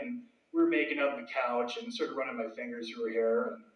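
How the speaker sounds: ordinary talk, nothing marked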